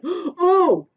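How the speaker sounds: high, loud startled gasp of oh; Sky King stunned by the request; shocked oh. Acted. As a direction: caught off guard, gasp it.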